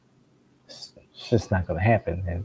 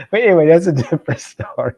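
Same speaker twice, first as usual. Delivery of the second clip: fast, louder, higher, laughing through it; 7 semitones higher, 7 dB louder, much faster than usual. Real, not acted. Laughing at his own dental story as he moves on. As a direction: rush the words out while still laughing. Laughing about lost teeth.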